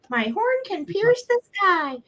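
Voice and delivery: high, grand unicorn voice; heroic unicorn voice. Pretend. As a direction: proudly voice the toy unicorn. Voicing the unicorn figurine.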